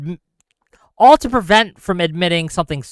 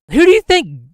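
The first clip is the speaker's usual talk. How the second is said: rhetorical outburst; 12 semitones higher, 8 dB louder than usual. Real, not acted. Angry rhetorical question.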